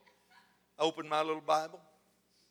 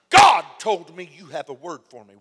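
retold loud demand, hard first word; 16 dB louder than usual. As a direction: imitate a man loudly demanding. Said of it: "very loudly".

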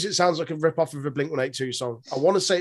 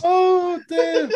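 very high, laughing sigh of words; high and giggly, cracking up; helpless laughter; 13 semitones higher, 5 dB louder than usual. Real, not acted. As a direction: squeal it out through laughter. Both hosts cracking up at joke.

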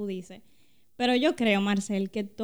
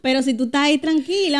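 much louder and higher, cheering her on; rally your friend with loud conviction; loud fervent encouragement; 5 semitones higher, 10 dB louder than usual. Real, not acted. Urging friend to stay calm.

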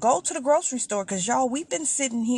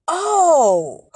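mock realization; 6 semitones higher, 8 dB louder than usual. Retold seller backtracking on price.

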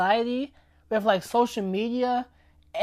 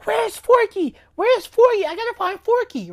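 much higher and louder, frantic calling out; a kid in a panic over her lost toy; panicked child; 11 semitones higher, 5 dB louder than usual. Retold or imitated. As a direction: play a little girl frantically searching. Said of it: "freaking out".

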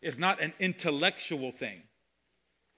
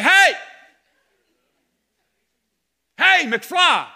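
high, loud mock yell of a fed-up wife; hammed-up shout of an annoyed wife; 11 semitones higher, 13 dB louder, much slower than usual. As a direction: bark it at him like a nagging wife to get his attention. Wife's annoyed voice acted in example.

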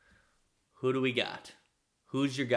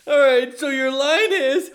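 excited; 12 semitones higher, 10 dB louder, slower than usual. Acted. Setting up a mock scene.

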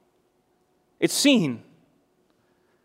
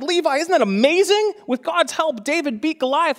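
put-on much higher, wonder-filled voice for a child; imitated parental amazement; 11 semitones higher than usual. Dad reading David and Goliath to young son, acted amazement. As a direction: act out awe to a little kid.

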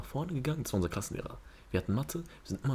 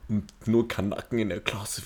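normal talking voice

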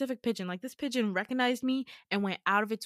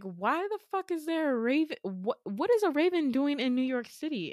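incredulous outburst; 5 semitones higher than usual. Retold or imitated. Retold outburst at the raven.

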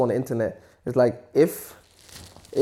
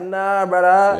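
dismissive refusal; 7 semitones higher, 5 dB louder, much slower than usual. Retold or imitated. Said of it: "I was like".

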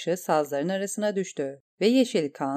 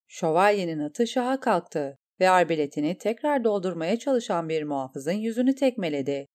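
ordinary delivery, nothing marked

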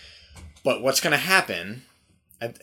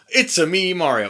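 Mario impression; 5 semitones higher, 5 dB louder than usual. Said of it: "he's gonna go".

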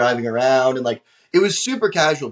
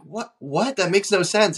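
higher-pitched, puzzled disbelief; retold bafflement; 7 semitones higher than usual. Hearing the palace opens at ten, baffled disbelief. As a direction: replay a mildly incredulous reaction. Retold confusion at host's claim.

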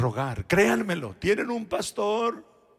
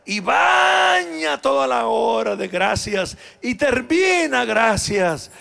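slow, raised preaching push; forceful preaching; 5 semitones higher, 7 dB louder, slower than usual. Acted. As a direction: pastor urging the congregation, slow and raised.

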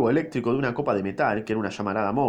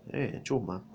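quiet aside; 3 semitones lower, 8 dB quieter, slower than usual. A narrator's reading. Aside to crew.

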